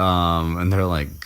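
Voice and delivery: ordinary talk, nothing marked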